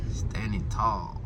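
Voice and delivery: ordinary talk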